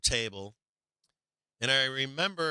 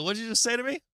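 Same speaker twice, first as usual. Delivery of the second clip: stunned disbelief; 9 semitones higher, much faster than usual. Retold or imitated. Shocked by her accusation.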